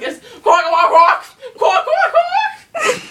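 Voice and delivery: quacking. Retold or imitated. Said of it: "it's like".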